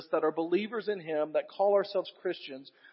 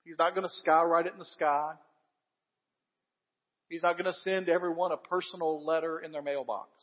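normal talking voice

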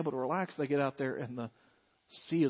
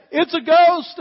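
loud, high cry of terror; fearful cry; 13 semitones higher, 14 dB louder, slower than usual. Acted. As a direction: cry it out as if terrified. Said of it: "they cried out in fear".

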